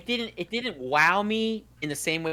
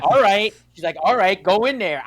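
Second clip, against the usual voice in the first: louder, higher eager okay; excited go-ahead; 5 semitones higher, 8 dB louder than usual. Retold or imitated. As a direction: fire up and give the go.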